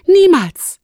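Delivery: high, loud outburst; indignant protest. Acted. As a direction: burst out in loud refusal. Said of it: "protestierte Lumi sofort". (lauter, hoher, empörter Protest)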